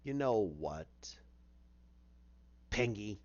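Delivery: low, creepy villain tease; Joker voice. Retold or imitated. Joker calls to Penguin from the dark, low and menacing. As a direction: Joker taunting Penguin through the door, low and sinister. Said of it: "that's when you hear".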